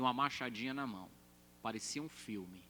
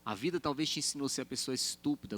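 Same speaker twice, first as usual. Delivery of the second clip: ordinary delivery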